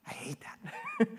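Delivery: whispered through a laugh. Real, not acted. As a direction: laugh it off under the breath. Laughs at grandma's joke.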